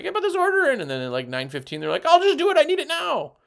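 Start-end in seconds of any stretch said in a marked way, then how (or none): 0.0-0.8 much higher, louder mock customer. Customers texting demands. 2.0-3.3 much higher, louder impatient whine; mock a needy customer; high demanding mock customer.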